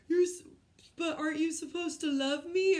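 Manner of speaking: mock her plea back with a grin; teasing coach. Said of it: "he said to me".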